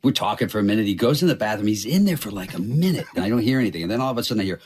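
usual speaking voice

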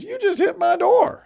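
high, indignant retold accusation; woman's accusation, high. Retelling the woman at the car, high and indignant. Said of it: "She goes".

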